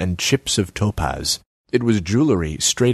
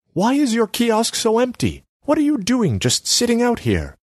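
higher pitched, nagging artsy woman; Selma's voice. Acted. Selma needles Borislav about his empty kiosk, high voice.

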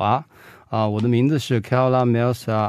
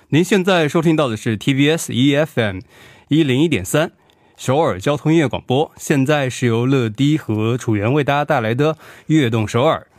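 字正腔圆的电台主持腔; 像电台播音员一样报台呼; 播音腔; 2 semitones higher than usual. Shown as a demonstration. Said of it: "用播音腔说说看". (标准播音腔，字正腔圆)

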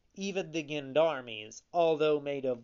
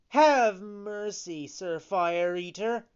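pleading; 6 semitones higher, 5 dB louder than usual. A narrator's reading. Pinocchio kneeling before Fire Eater, high pleading. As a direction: beg loudly for mercy. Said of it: "Then he pleaded".

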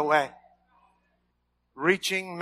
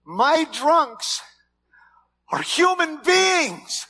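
shout; 9 semitones higher, 4 dB louder, much slower than usual. Real, not acted. Preacher's emphatic declaration.

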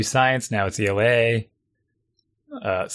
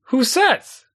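sharp incredulous "who says", pitched much higher; incredulous retort; 11 semitones higher, 3 dB louder than usual. Retold or imitated. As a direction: toss it back in mock disbelief. Arguing with son over homework.

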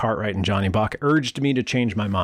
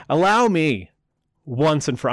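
snotty; 5 semitones higher than usual. Retold or imitated. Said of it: "I'm gonna say it all snotty too".

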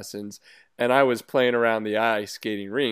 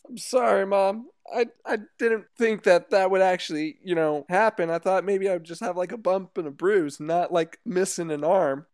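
put-on higher, childlike son voice; kid voice; 6 semitones higher than usual. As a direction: play a sheepish young boy. Son replies to mom in sketch.

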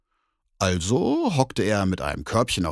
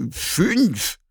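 bear calling out; 11 semitones higher, 3 dB louder than usual. Acted. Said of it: "rief der Bär".

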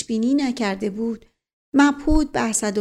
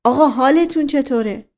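raised, urgent call; crying out; 3 semitones higher, 5 dB louder than usual. A narrator's reading. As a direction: call out in alarm, building louder. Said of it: "آقا جان فریاد زد". (با فریاد و نگرانی)

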